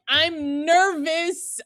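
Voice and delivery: mock nervous. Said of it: "I immediately was like".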